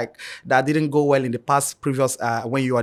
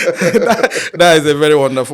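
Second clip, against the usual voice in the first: words spilling out through laughter, higher and louder; laughing; 4 semitones higher, 11 dB louder than usual. Real, not acted. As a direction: keep laughing as you say it. Host laughing through his reply.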